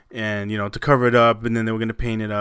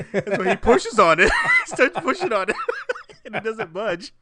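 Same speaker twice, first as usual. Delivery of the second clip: high-pitched, helpless laughing narration; laughing hard; 11 semitones higher, 4 dB louder than usual. Real, not acted. Host describing the intern pushing on sheetrock, laughing hard. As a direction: tell it while cracking up. Breaking up telling a funny story.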